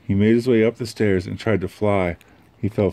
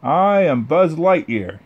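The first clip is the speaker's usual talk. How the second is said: higher, slow, louder grand announcement; heroic space ranger; 8 semitones higher, 5 dB louder, slower than usual. Acted. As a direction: proclaim it like a hero. Buzz announces himself to aliens.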